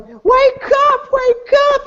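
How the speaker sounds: frantic high scream; crying, screaming girl. Acted. Girl shaking her friend, frantic high scream. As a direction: scream high and desperate to rouse someone. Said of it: "crying and screaming".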